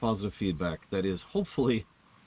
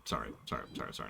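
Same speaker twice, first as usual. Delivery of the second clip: muttered apology; 10 semitones lower, 11 dB quieter, much faster than usual. Real, not acted. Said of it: "muttered apology after misspeaking".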